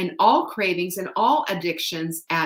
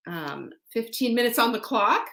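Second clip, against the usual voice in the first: usual speaking voice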